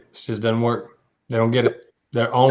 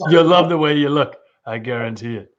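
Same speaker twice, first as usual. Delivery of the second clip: ad slogan voice; 4 semitones higher than usual. Acted. Co-host quotes store's slogan.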